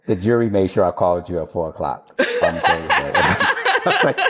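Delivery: laughing while quoting the punchline; amused, chuckling through it. Retold or imitated.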